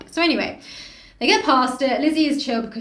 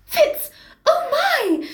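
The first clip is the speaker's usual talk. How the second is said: high, loud delighted cry; overjoyed greeting; 4 dB louder, much slower than usual. Acted. Daughter greets her father at the gate, overjoyed. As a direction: exclaim with overjoyed surprise. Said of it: "is overjoyed to see everyone".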